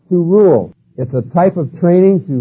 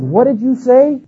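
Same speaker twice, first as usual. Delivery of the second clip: high-pitched indignant challenge; retold outrage, pitch shooting up; angry instructor; 10 semitones higher, 3 dB louder, slower than usual. Instructor confronting a recruit who answered back, angry and high-pitched. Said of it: "guy got all red in the face".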